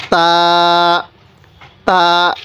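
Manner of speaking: তা with the tongue on the upper gum, vowel held; touch tongue behind upper teeth, then a long level তা; teacher's drawn-out demo of তা; tongue-to-gum articulation. Shown as a demonstration. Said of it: "জিবাকে উপরের দাঁতের মাড়ির সাথে".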